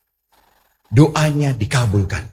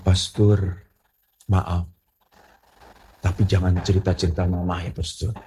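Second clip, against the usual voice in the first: acted hesitant confession; 5 semitones lower, 6 dB quieter than usual. Woman begins a reluctant confession.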